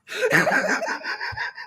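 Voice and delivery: very high cackle of laughter; high shrieking laugh. Real, not acted. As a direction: collapse into laughter. Laughing after bit.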